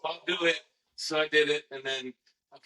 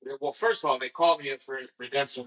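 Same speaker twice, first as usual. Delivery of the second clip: usual speaking voice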